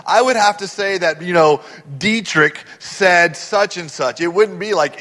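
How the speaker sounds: ordinary talk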